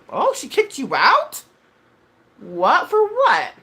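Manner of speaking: loud, high, incredulous questions; real astonishment, loud and high; shocked disbelief. Real, not acted. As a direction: shoot the pitch way up in surprise. Surprised by news in the chat.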